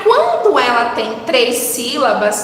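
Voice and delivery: usual speaking voice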